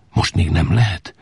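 ordinary delivery, nothing marked

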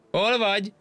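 very high distant-style call. A narrator's reading. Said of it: "már kiabált a másik fától". (magas hangon kiabálva)

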